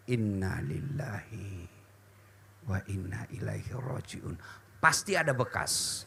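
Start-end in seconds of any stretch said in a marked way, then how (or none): 0.1-4.3 much softer, lower, slow murmur; slow quiet prayer murmur; soft recitation. Shown as a demonstration. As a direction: murmur the verse while stroking your chest. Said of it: "Ucapkan innalillah, lalu usap dadamu".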